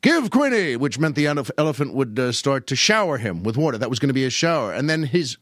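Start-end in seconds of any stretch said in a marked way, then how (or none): 0.0-0.8 high, swooping command; W. C. Fields impression. Circus man orders his elephant to spray, high call. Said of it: "and he'd say".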